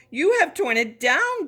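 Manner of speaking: high, loud accusing cry; upset cry. A narrator's reading. Brother crying out at Juniper, high and loud. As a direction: wail it out in dismay. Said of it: "cried Brother Sylvester".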